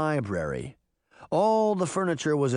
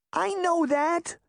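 much higher, slow and insistent; upset little girl; slower than usual. Acted. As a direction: Daphne protesting to her sister. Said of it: "the little girl cried".

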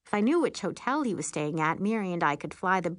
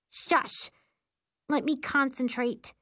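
irritated shush; 6 semitones higher, slower than usual. A narrator's reading. Sister needs to concentrate.

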